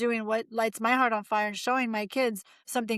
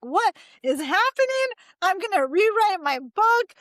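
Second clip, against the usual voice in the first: higher, louder, slowed-down mock panic; panicked outburst; 8 semitones higher, 4 dB louder than usual. Author retelling panic at her agent's rejections, higher and louder. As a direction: replay your own freak-out with a raised, strained voice.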